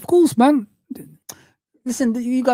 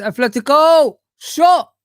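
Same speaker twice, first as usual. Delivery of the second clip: sudden loud, high "Shot!"; watching live, shouting as the shot comes; excited shout; 8 semitones higher, 7 dB louder, slower than usual. Real, not acted. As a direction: jump up as the shot goes in. Live match moment, shot.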